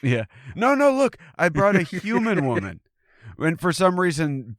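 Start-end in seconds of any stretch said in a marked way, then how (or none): 0.5-2.8 very high, hurried-sounding appeasement; squeaky mock-frightened protest; mock panicked plea. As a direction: plead for your life, hammed up. Pleading with a threatening Bigfoot.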